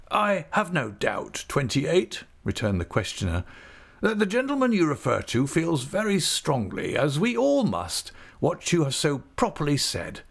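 a self-satisfied official praising the prisoner; high, smug magistrate voice. A narrator's reading. Magistrate commending a prisoner in a high smug voice. Questioner answers Littimer.

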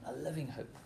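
living hope dropped to a hush; preacher echoing the phrase in a soft hush; soft repeat. Shown as a demonstration. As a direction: let the repeat fade softly. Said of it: "to a living hope".

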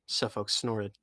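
usual speaking voice

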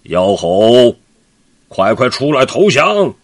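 giant's shouted demand to surrender; loud shout. Acted. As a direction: roar it at the cave. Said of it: "大喊".

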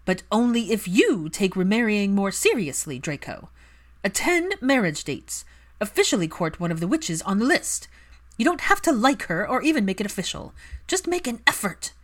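a hint of an insistent aristocratic mother pressing her son; Narcissa's pleading demand. A narrator's reading. Narcissa voiced by reader.

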